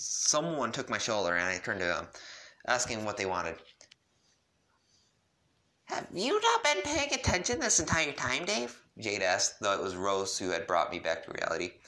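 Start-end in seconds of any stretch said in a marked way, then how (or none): 5.9-8.8 lightly voiced teen girl, much higher; high girly voice. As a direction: pitch it up for Jade.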